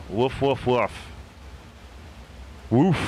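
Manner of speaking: playful pretend woofing; dog woof. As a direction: bark like a dog for the crowd. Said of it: "I need a track woof".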